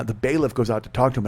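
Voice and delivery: usual speaking voice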